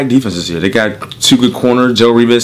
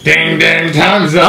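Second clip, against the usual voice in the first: calling time; 6 semitones higher than usual. Pretend. Calls time on the game.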